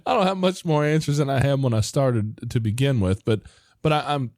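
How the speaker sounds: ordinary talk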